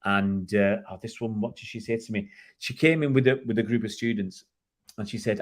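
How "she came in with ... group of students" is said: usual speaking voice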